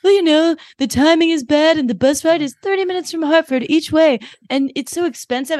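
high-pitched mock teen making excuses; moody teen. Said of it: "they're both, like, in a fucking mood".

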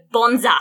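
bonza said BON-za, nasal o, falling; Australian bonza. Shown as a demonstration. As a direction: teach bonza with a strong first syllable. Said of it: "Give you another Australian word".

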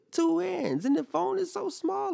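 high, incredulous complaint; exasperated whine. Real, not acted. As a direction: squeal it in disbelief at how tiny it is. Complaining about the tiny phone.

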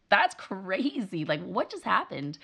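slow, drawn-out mock astonishment; amazed aside. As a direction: stretch it out, baffled by the tantrum. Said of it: "the only way you could be like".

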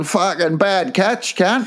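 slow, drawn-out mock jeer; heckling inmate. Inmates heckling him in the yard, slow mock jeer.

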